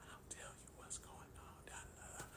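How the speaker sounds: hushed, voiceless whisper. Retold or imitated. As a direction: imitate a wife whispering because her throat hurts. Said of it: "she's still whispering".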